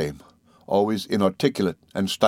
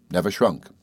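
ordinary delivery, nothing marked

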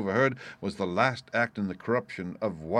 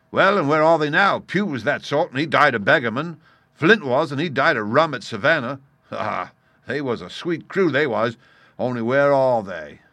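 loud, raised rhetorical challenge; mock his dead shipmates; scornful pirate leader; 5 semitones higher, 9 dB louder than usual. A narrator's reading. Silver mocks Israel's old crewmates.

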